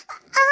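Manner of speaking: pained wailing moan; cry of labour pain.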